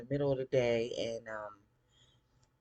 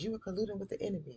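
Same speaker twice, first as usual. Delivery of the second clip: fast, high-pitched accusing quote; imitating an indignant accuser; mock accusation; 10 semitones higher, much faster than usual. Same acted accusation, repeated.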